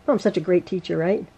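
low-pitched, wry self-deprecation; self-mocking. Real, not acted. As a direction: sarcastically praise yourself under your breath. Mocks herself after repeated mistakes.

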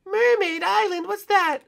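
very high squeaky cartoon voice; high Yoshi voice.

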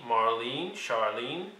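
ordinary delivery

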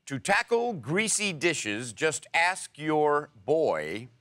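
slow, deliberate quiz clue; slow clue reading. Shown as a demonstration. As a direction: draw out each word so players can guess. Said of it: "reads a slow riddle clue".

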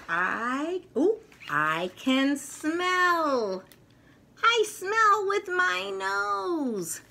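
high, slow delighted toy voice; toy's voice. Acted. Potato Head toy discovering he can smell, high and slow. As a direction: let the toy discover his nose with wonder.

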